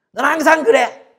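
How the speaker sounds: high, loud mock accusation; accusing you-message. Shown as a demonstration. Pastor demonstrating violent you-messages, loud accusing tone. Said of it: "유 메시지는 항상 폭력입니다".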